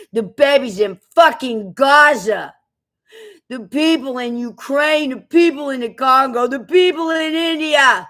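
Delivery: rising fervor, item by item; building rant. Real, not acted. Ranting list of suffering people.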